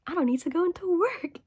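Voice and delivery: high, loud burst of mock joy; light up as the good news lands; excited realization. Worker realizing he can skip work, high mock glee.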